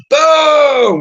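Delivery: loud, high-pitched imitated bang; sudden explosive sound effect; shouted boom. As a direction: boom it out like a door kicked in. Imitates door being kicked open.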